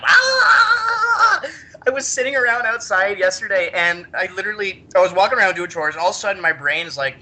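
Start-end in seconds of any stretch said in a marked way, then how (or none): 0.0-1.4 long, high mock scream; crazed scream. Telling how spring break has been, crazed scream. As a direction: let out a drawn-out loony wail. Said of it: "I've just been like".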